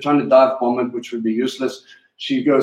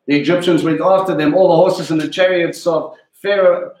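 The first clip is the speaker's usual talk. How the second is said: scripture reading; 4 semitones higher than usual. Acted. Reads scripture aloud.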